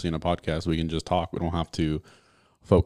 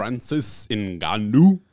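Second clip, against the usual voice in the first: drawn-out hype; 5 semitones higher, 8 dB louder, much slower than usual. Real, not acted. Hyping the fighter's name.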